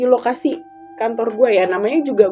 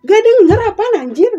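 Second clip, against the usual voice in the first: loud, high irritated brother; annoyed brother yell; 11 semitones higher, 8 dB louder than usual. Retold or imitated. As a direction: snap back louder and higher like an annoyed older brother. (abang yang kesal, bentak dengan suara tinggi)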